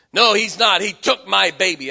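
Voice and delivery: loud, bitter denial; furious mother insisting; angry retort. Retold or imitated. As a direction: snap back hard in grief. Mother shouting back.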